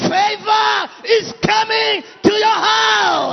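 rising, shouted declarations; preaching building up. Acted.

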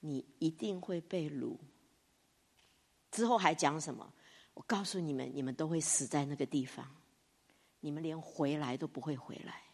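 a hint of a stern prophet; stern warning. Jeremiah answering the king, quiet and grave. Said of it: "耶利米怎么回答他".

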